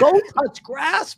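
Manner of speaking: high, slow scoff at miserable creators; drawn-out go touch grass jab; mock telling off. To miserable creators, a high, slow mocking jab. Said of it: "And I'm like".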